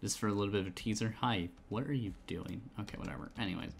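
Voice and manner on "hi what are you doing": normal talking voice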